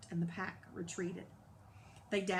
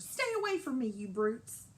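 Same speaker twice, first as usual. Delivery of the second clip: high, loud desperate holler; 4 semitones higher, 4 dB louder than usual. Acted. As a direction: holler it at the attackers. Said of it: "kicked and hollered".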